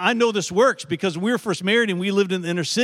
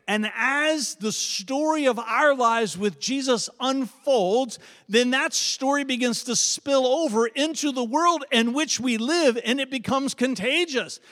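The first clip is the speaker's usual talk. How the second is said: slow, higher preaching that climbs toward the question; rising sermon build; 6 semitones higher, slower than usual. Acted. Pastor to the church, building slow and high. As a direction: lift the congregation with a swelling sermon line.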